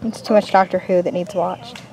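usual speaking voice

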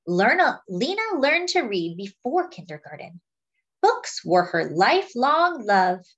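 put-on lively picture-book read to children; story narration. A narrator's reading. Reading a Lena Horne picture book to friends, lively storytelling. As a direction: tell it like a storyteller to a class.

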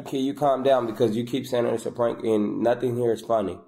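ordinary talk, nothing marked

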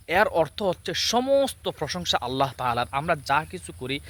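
ordinary talk

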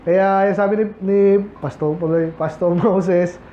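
ordinary talk, nothing marked

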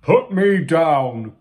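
loud, slow villain's protest; big character's indignant yell. A narrator's reading. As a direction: yell it out, dragging the words. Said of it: "Big Ron yelled".

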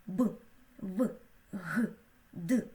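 "Б, В, Г, Д" as short, hard consonant sounds. Shown as a demonstration. Teacher shows how hard sounds are pronounced, hard "Б, В, Г, Д". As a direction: say "Б, В, Г, Д" unpalatalized, one sound at a time. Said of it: "Ось як вимовляють приголосні тверді звуки".

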